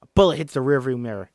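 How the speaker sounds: ordinary delivery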